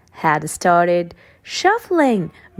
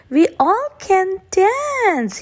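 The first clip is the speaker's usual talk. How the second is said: warm, slow; 12 semitones higher than usual. A narrator's reading. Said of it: "he said".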